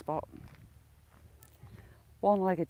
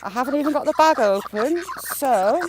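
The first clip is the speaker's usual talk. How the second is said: flustered laugh-shout; 5 semitones higher, 7 dB louder than usual. Real, not acted. Ducks crowd her.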